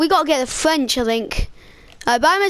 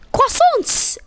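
ordinary talk, nothing marked

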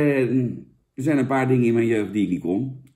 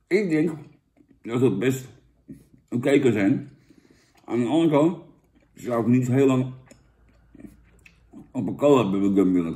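talking between chews; chewing gum while talking. Real, not acted. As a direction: mouth partly full of gum. Seen 3: Gum put in mouth near -5; jaw and lips chew while talking.

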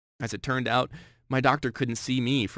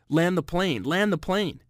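mild mock yell, raised pitch; retold panicked scream; 6 semitones higher, slower than usual. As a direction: re-enact a panicked cry in a higher, half-contained shout. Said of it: "screaming at the top of my lungs".

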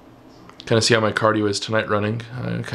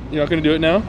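usual speaking voice

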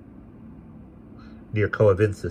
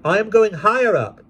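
put-on higher polite young woman; young lady; 7 dB louder than usual. A narrator's reading. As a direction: answer gently and politely. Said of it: "said I".

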